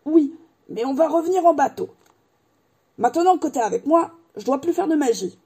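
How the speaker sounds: a hint of a jolly giant; giant Hagrid voice. A narrator's reading.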